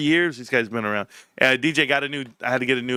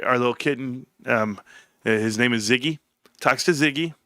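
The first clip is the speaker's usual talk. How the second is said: ordinary talk, nothing marked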